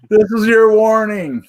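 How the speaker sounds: hammed-up spooky warning; mock ominous warning.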